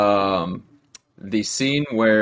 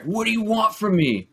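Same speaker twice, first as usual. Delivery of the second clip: much higher, exasperated plea; arguing lover; 11 semitones higher than usual. Retold or imitated. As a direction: throw it back in the middle of a fight. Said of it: "he's like".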